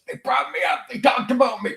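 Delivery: high, giddy mock voice. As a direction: squeal it like a thrilled fan.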